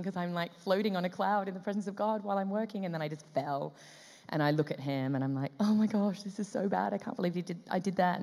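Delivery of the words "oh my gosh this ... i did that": higher-pitched, dismayed gush; mortified. Retold or imitated. Actress apologising to director after fumbling, higher mortified gush. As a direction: fret over your own blunder.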